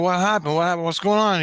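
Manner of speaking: high, loud, urgent questions; alarmed questioning. Retold or imitated. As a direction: fire off startled questions in quick succession. Retold reaction to kids screaming.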